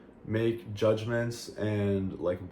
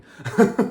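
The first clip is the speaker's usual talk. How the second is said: loud high giggle breaking out; a giggle fit at a funny memory; real burst of laughter; 12 semitones higher, 10 dB louder than usual. Real, not acted. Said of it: "I'm, like, laughing".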